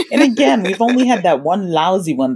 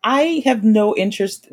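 ordinary talk, nothing marked

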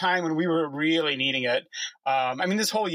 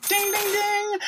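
bell imitation; slower than usual. Game-show correct answer bell.

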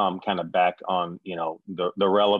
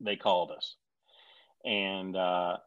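ordinary talk, nothing marked

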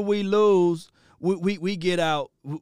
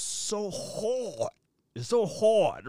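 HARD drawn out with a high pitch swoop; emphatic "so hard"; 5 semitones higher, much slower than usual. Real, not acted. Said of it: "I gotta put it like that".

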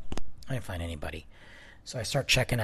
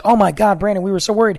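mock worried chaperone; 8 semitones higher, 7 dB louder, faster than usual.

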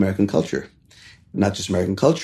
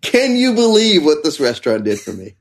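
customer marvelling at the restaurant, voice pitched way up; amazed customer; 10 semitones higher than usual. Retold or imitated.

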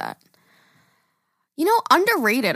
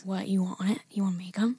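whispering; 4 semitones lower, 9 dB quieter than usual. Real, not acted. Whispering aside.

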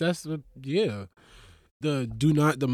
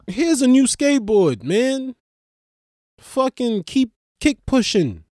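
higher, louder, hearty; generous donor; 8 semitones higher, 4 dB louder than usual. Pretend. Comic playing a rich man giving a kid a skateboard, higher. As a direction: cheer a kid on as you hand over a board.

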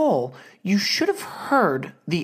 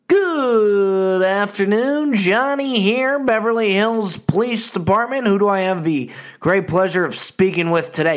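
nonchalant operator; 4 semitones higher, 3 dB louder than usual. Retold or imitated. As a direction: stretch each word like a leisurely concierge. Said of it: "It was just the most nonchalant answer I've ever heard".

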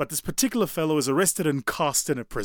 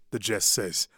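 ordinary talk

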